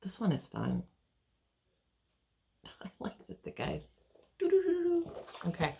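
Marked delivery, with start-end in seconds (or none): 4.4-5.1 mock flute tootle, pitched high; high sung doo-doo tootle. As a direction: toot a little high tune on doo-doo. Imitates the flute serenade.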